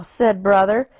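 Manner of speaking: usual speaking voice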